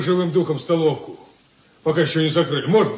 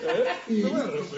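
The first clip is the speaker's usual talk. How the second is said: playful laughing demand; 7 semitones higher, slower than usual. Acted. Tickling friend for money.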